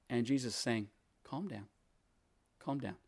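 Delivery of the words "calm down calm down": soft, hushed settling words; calm. Acted. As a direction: quietly soothe an upset person, acted. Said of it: "interesting to see that calmness".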